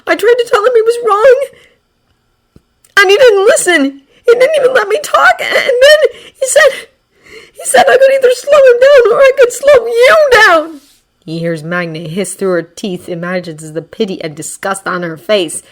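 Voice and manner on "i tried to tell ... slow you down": high, loud, sobbing with broken laughs. Acted. Izuku repeating the bully's words, sobbing. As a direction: cry it out, bitter and broken.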